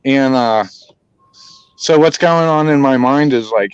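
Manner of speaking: ordinary delivery, nothing marked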